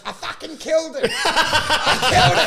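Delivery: high, loud imitated celebration; triumphant boast. Pieman coming off stage, acted triumphant boast. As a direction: crow about your success.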